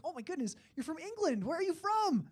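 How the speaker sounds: high, gushing cashier impression; really interested cashier. Cashier quizzing a supposed Englishman, high and really interested. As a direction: pitch it up, rising in volume with keen interest. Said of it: "she was really interested".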